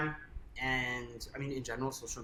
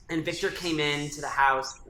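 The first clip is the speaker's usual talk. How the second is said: ordinary delivery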